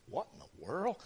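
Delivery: very soft, baffled thought to himself; hushed aside. Retold or imitated. Boy hearing a strange noise behind him, hushed bafflement. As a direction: mutter it under your breath, puzzled.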